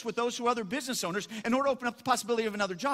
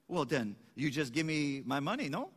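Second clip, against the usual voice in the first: lower, put-on Cajun accent; 7 semitones lower, 3 dB quieter than usual. Boudreaux asks the farmer for his money back, Cajun accent. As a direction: have a go at an imperfect Cajun accent. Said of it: "I won't get the right accent".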